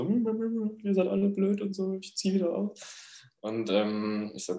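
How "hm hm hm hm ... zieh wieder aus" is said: higher pitched, grumbling mutter to herself; mock roommate muttering. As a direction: imitate the sulky roommate grumbling under her breath. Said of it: "noch so vor sich hin so". (nachgeäffte Mitbewohnerin, hoch und vor sich hin grummelnd)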